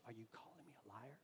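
a low, breathy whisper of menace; menacing whisper. Retold or imitated. Wife whispering a threat at her husband, menacing.

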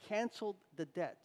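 ordinary delivery

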